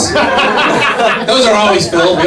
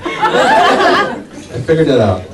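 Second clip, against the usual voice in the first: slow emphatic; 3 semitones lower, much slower than usual. Shown as a demonstration. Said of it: "Wait, I'm not done yet".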